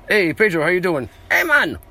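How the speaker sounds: bright high call to a friend; big upbeat hello; greeting Pedro. Real, not acted. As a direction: hail Pedro across the room, pitch leaping up.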